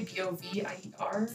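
letters B O V I E R named one by one; spelling it out. Shown as a demonstration. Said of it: "follow me at [name]".

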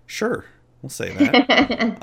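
slow high-pitched sarcastic drawl. Real, not acted. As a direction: drag it out, high and knowing. Sarcastic reply to teasing.

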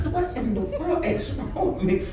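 softly acted bewilderment, under the breath; hushed panic. Student to himself, sweating in class, hushed panic.

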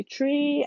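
"tree" for three, plain T at the start. Shown as a demonstration. Owning an accent to listeners, TH said as T in "tree". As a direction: say "tree" with a hard T, no TH. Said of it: "I don't say my T".

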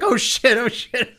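high panicked yelp; mock panic. Panicked character after hitting wife.

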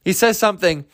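ordinary talk, nothing marked

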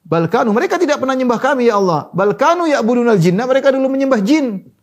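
high, forceful denial in the angels' words; impassioned preaching. Acted. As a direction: preach the denial at full pitch.

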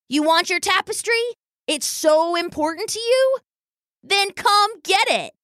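high-pitched, provoking little brother; taunting challenge. A narrator's reading. Brother about to snatch the tapestry, taunting his sister. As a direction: dare her, slowing on the last phrase.

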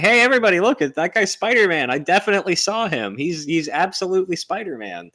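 high, loud blurting it out; excited shouting. Pretend. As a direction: shout it to the whole crowd. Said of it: "he could have been like".

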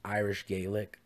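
ordinary talk, nothing marked

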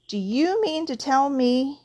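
incredulous, rising anger; growled demand. A narrator's reading. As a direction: growl it as an outraged question. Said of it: "he growled at the Dursleys".

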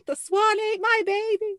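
high, loud, hammed-up wail; mock wail. Mother wailing over her dead daughter, mock high wail. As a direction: cry it out like a grieving mother, over the top. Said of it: "That's what, um, Kirstie Alley is yelling".

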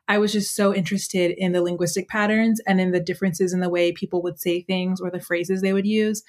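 ordinary talk

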